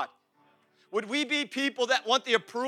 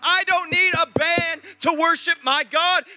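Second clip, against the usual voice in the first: loud, raised, driving sermon voice; impassioned preaching; 6 semitones higher, 8 dB louder than usual. Real, not acted. Preacher vows to praise God without a band, impassioned preaching. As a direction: preach it at full force, building with each promise.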